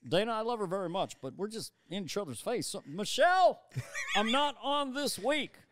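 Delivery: laughing Obama voice; Obama impression. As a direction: Obama confiding to Dana, then calling Michelle. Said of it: "my Obama".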